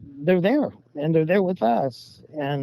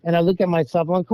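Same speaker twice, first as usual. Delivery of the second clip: ordinary talk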